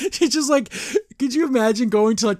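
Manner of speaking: high, giggly and incredulous; laughing disbelief. Real, not acted. Laughing at the absurd find.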